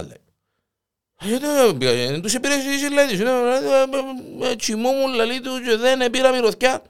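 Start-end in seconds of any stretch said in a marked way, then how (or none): 1.3-6.8 innocent wife. Retold or imitated. Wife voiced high and innocent.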